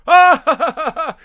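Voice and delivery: high, loud laughing yelp; mock laughing ah. Mother and daughter sharing a laugh over the body, a high loud laughing yelp. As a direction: let out a sudden giddy laugh. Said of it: "And they go".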